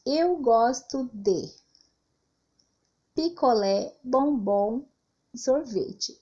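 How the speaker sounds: slow, with pauses between "picolé", "bombom", "sorvete"; slow list read with clear breaks; list with commas. Shown as a demonstration. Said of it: "por exemplo".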